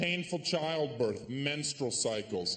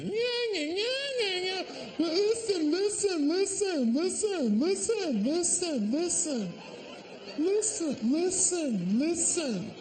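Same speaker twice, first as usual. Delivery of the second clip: very high, squeaky nagging voice with repeated listen; mock a whiny girlfriend begging for attention. Comic mocks a girlfriend who won't stop nagging, in a squeaky whine.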